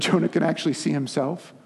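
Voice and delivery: ordinary talk, nothing marked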